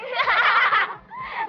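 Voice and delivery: loud jeering laugh; mocking laugh. Mocking the choir kids.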